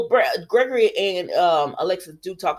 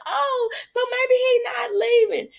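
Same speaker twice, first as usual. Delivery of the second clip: high, hopeful little cry; hopeful exclamation. Retold or imitated. Viewer hoping Brando isn't leaving, high hopeful cry. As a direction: let the hope jump the voice up high. Her hopeful reaction to the show.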